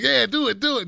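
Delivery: playing the fans who keep pushing him; mock eager fans. Host imitating viewers urging him on, mock eager. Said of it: "I know people are like".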